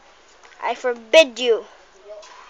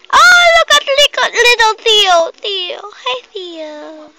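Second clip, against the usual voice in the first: high, loud squeal softening into a coo; real high-pitched cooing, fading to a greeting; 9 semitones higher, 10 dB louder than usual. Real, not acted. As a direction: squeal over a tiny kitten, then settle. Cooing over a kitten.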